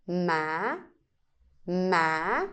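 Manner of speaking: sắc tone on ma, rising; Vietnamese rising tone. Shown as a demonstration. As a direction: start ma low and slide up. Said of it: "raise your voice with this tone".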